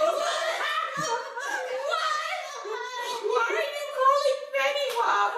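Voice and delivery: high, loud, laughing disbelief; cracking up while acting baffled; incredulous laughter. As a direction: squeal it through helpless laughter. Reenacts her baffled laughing reaction.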